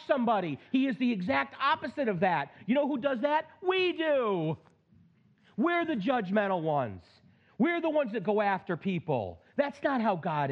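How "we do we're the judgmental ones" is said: ordinary delivery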